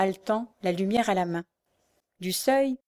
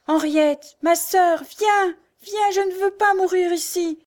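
very high, pleading screams; desperate cry; 10 semitones higher, 5 dB louder, slower than usual. A narrator's reading. Blind girl cries for her sister, desperate. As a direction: call out desperately for help. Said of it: "d'une voix désespérée, elle se mit à crier".

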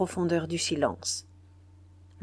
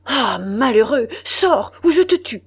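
loud, much higher furious shout; murderous threat; 5 semitones higher, 9 dB louder than usual. A narrator's reading. The enraged general threatens to kill the intruder, shouting. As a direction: threaten to kill him, out of control.

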